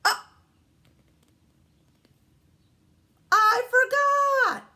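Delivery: put-on very high, loud, drawn-out kid cry; stretched, high little-boy wail; David's exclamation. A narrator's reading. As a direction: shout it like a child who just remembered. Voicing David, exclamation point read.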